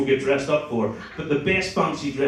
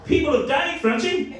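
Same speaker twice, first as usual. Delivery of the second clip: outraged disbelief; 10 semitones higher than usual. Real, not acted. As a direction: warn her, half laughing. Outraged warning to audience.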